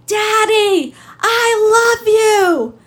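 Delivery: high, loud and stretched out; crying scream. Retold or imitated. Little girl screaming to her dad through the prison glass, crying shout. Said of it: "she screams".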